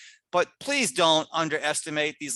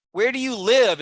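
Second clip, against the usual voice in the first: "Where do you live?" called out higher and louder, with "live" falling firmly; loud, emphatic correct form; 7 semitones higher, 7 dB louder than usual. Shown as a demonstration. Teacher proclaiming the correct question to the class. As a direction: announce the right version with force. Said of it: "is correct".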